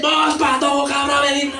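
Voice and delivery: mock hostile yell at someone on the street; hostile shouting. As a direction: bark it like a hostile thug. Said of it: "algo hostil es".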